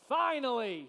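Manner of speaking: loud relieved cry of finally; relieved exclamation. Shown as a demonstration. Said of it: "who breathed this deep sigh of relief, and he said".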